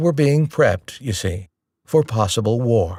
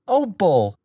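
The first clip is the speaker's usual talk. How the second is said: higher, mild mock yell of protest; 8 semitones higher than usual. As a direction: mom snapping back in disbelief. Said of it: "Mom yelled".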